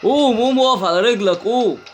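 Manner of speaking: panicked get up get up; frantic urging. Real, not acted. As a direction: push the character up in a rush.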